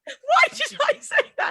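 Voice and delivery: high-pitched laughing mock horror; retold dismay. Host retelling her radio accent slip, mock-horrified. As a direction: squeal it, mortified at yourself.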